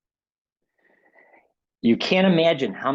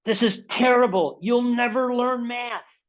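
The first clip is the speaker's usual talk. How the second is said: much higher, scornful verdict, slow on never learn math; teacher crushing a seven-year-old, mock scornful; harsh teacher; 12 semitones higher, slower than usual. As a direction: condemn the child's work. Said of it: "here's the download".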